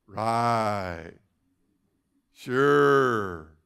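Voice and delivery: low, disbelieving Right, Sure; sarcastic doubt. Acted. Doubting disciple scoffs at the gold coin promise, sarcastic. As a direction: scoff at it like you don't buy it.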